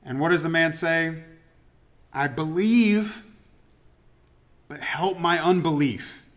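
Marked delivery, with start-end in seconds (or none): 2.1-6.2 pleading confession. Retold or imitated. Father's desperate confession.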